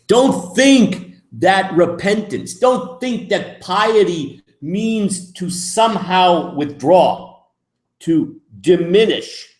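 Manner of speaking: slow, high, loud pleading; emphatic exhortation. Real, not acted. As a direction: drive each don't home. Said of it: "slow emphatic exhortation".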